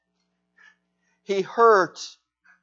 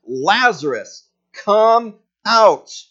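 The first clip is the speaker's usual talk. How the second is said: a commanding cry, louder; loud call; 4 semitones higher, 7 dB louder, much slower than usual. A narrator's reading. Jesus calling Lazarus out of the tomb, loud call. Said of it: "he called out in a loud voice".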